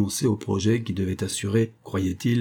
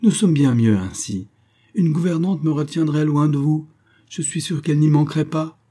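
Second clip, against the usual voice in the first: higher, louder urgent plea; eager pleading; 7 semitones higher, 5 dB louder than usual. A narrator's reading. Daughter begging her father to drop the idea, urgent. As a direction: plead eagerly, voice raised in pitch. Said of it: "s'écria-t-elle avec empressement".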